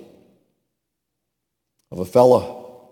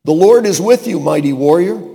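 proclaiming; 5 semitones higher, 7 dB louder than usual. Acted. Preacher proclaims God's words.

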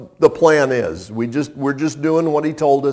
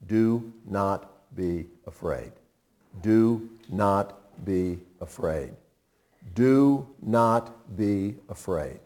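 softer and slow, calming repeats; gentle reassurance; 8 semitones lower, 9 dB quieter, slower than usual. Acted. God speaking to his frightened people, softer, slow and reassuring. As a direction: soothe a frightened listener.

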